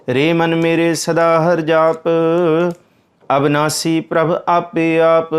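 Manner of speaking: slow, drawn-out devout intoning; scripture recitation. Acted. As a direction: chant each word like a prayer. Recites a hymn line.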